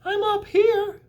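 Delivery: very high, slow tiny voice; tiny spider greeting the pig; small spider voice. Acted. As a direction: squeak it like a baby spider. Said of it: "said another tiny voice".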